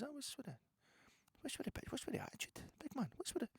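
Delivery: squeaky high spider voice, cocky; talking spider. Pretend. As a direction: play the spider sneering at the big man. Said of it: "he looked at me and he says".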